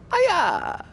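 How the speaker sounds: loud, very high "ah, yeah"; high whoop. Acted. Player cheering after a beer slogan, high loud whoop.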